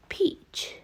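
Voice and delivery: whispered "Peach", no voice; soft whispered "Peach" for learners. Shown as a demonstration. Whispered repetition of word.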